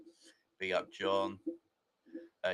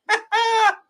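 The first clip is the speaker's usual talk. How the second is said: high, loud burst of laughter; howling laugh; big laugh; 13 dB louder than usual. Real, not acted. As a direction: crack up at the meme. Laughing at the meme.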